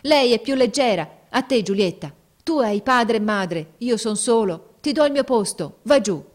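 loud, high cry in a voice not his own; grido stravolto. A narrator's reading. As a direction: shout it with selfless urgency. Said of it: "con una voce che non parea più la sua, gridò". (grido forte, con voce stravolta)

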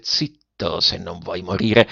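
a robber's quiet warning, low and cold; low threat. A narrator's reading. Masked robber threatens the boy.

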